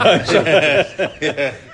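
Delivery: hearty embarrassed laugh; loud laugh. Real, not acted.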